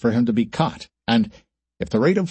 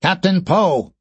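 shout; 7 semitones higher, slower than usual. Acted. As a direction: shout across the bridge.

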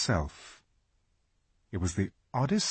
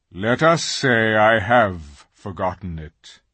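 slow, weighty lion's answer; grave; 6 dB louder than usual. A narrator's reading. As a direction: answer gravely, loud and unhurried. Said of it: "answered Aslan gravely".

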